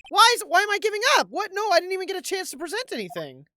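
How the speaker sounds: high, loud, fast protest; real indignant yelling, words tumbling out; outraged disbelief. Real, not acted. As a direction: shout it like you can't believe you lost. Outraged at losing the trial.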